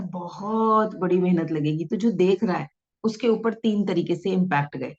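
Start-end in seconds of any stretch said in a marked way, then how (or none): 0.0-1.8 slow, drawn-out बहुत बड़ी मेहनत लगेगी; slow, heavy emphasis on the effort; slow with hand gesture. Shown as a demonstration. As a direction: weigh each word slowly as you gesture. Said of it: "हमने कहा".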